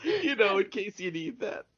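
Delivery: high, laughing through the words; laughing quip. Real, not acted. Cohost laughing at the parachute piano, high and giggly. Joking about the piano gag.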